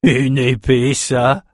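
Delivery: incredulous sailor's jeer; mocking. A narrator's reading. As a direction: sneer at the puny weapon. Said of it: "railla un matelot".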